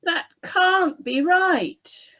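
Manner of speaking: slow, high, tearful soldier voice; a man near tears in protest; soldier in distress. Acted. Soldier close to crying at the verdict, slow and high.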